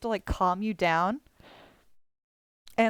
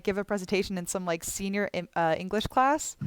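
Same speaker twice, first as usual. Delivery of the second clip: ordinary talk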